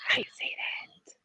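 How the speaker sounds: whispered, breathless disbelief. Real, not acted. Asking how anyone could say that, whispered. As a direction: whisper it in shock. Incredulous aside.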